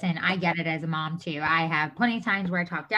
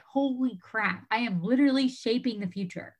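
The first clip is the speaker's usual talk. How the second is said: awestruck; 3 semitones higher than usual. Retold or imitated. Mother realizing she shapes her kids' future, awestruck. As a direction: let the realization hit you.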